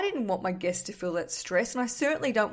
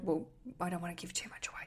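whisper; 2 semitones lower, 10 dB quieter, faster than usual. Real, not acted. Spoiler aside about House of Cards.

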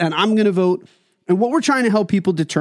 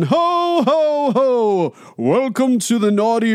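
jolly booming Santa laugh; 4 semitones higher, slower than usual. Pretend. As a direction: ho ho ho like Santa Claus. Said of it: "the Santa Claus bit".

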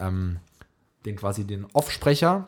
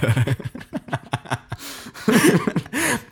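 stifled giggles; 8 semitones higher than usual. Real, not acted. Laughs mid weather report.

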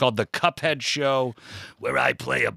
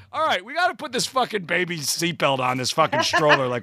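exasperated retelling; 8 semitones higher than usual. Retold or imitated. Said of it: "And I was like".

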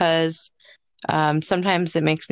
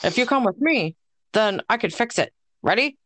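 higher cartoon dude voice; Soos; 4 semitones higher than usual. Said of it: "That was my Seuss impression".

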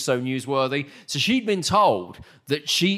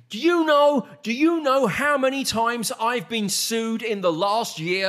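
[name] rant; 6 semitones higher than usual. Retold or imitated. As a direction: rant at the interviewer. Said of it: "she went into this rant".

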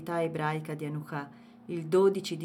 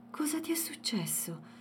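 whisper; 7 semitones higher, 5 dB quieter than usual. A narrator's reading. Husband tending wounded wife.

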